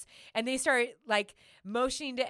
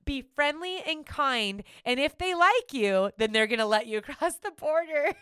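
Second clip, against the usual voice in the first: mock disbelief; 6 semitones higher, 5 dB louder than usual. Retelling own incredulous thought.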